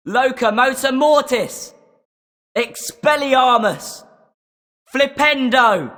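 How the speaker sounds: battle cries of incantations; shouted spells. Acted. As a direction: fire each spell off with force. Casting spells in a duel.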